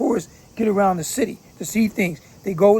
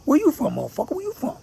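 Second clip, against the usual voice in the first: mock challenge; 8 semitones higher, faster than usual. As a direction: play a gang member confronting a stranger. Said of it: "That's how they talk".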